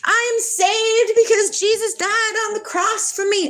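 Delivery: high, smug mock pious voice; sing-song mocking church voice; mocking churchgoer. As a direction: parody a self-satisfied believer. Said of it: "they go to church and say".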